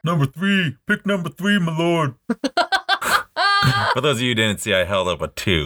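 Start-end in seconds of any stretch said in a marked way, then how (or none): 0.0-2.2 higher, put-on courtly voice; hammed-up royal attendant; Shrek quote voice. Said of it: "like in the classic movie Shrek". 2.2-3.9 high laugh. Real, not acted. Laughing at own Shrek bit.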